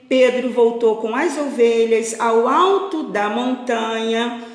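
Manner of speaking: expressive storyteller with drawn-out key words; lilting picture-book reading; children's story narration. A narrator's reading. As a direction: read it aloud to a class of little ones. Reads story to pupils.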